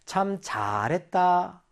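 slow, drawn-out sarcastic praise; 비꼬는 칭찬. Acted. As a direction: scold by stretching out mock praise. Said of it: "아, 우리를 혼내고 있구나". (혼내듯 길게 늘인 반어적 칭찬)

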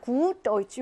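"Gut" with a long, held u; long vowel. Shown as a demonstration. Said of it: "이렇게 길게 합니다".

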